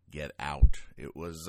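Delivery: ordinary talk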